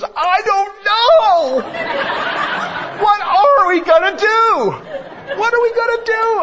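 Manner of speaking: put-on very high, loud laughing child's wail; son's panicked laugh. Son to his dad, a high laughing wail of panic. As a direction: squeal it like a panicked little boy. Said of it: "he looks up to me and goes".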